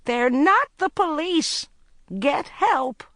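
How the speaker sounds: high, loud, slow desperate shout; frantic final yell. A narrator's reading. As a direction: shout the warning across the hall. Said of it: "shouted to Court".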